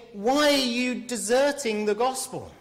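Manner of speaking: high, loud, incredulous demand; astonished, shocked. Acted. Paul confronting those deserting the gospel, shocked. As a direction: demand it in sheer disbelief. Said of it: "He's astonished, shocked, surprised".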